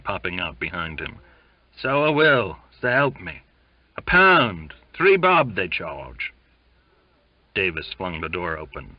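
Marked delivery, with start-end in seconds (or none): 1.8-6.3 loud, raised, insistent promise; yelped protest. A narrator's reading. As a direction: keep yelping your promise after him. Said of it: "yelped Donkin".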